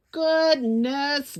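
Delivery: ordinary delivery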